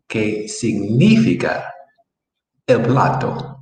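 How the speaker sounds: slow and careful. Shown as a demonstration. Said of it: "let's see how that works".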